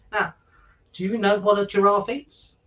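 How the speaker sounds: plain reading voice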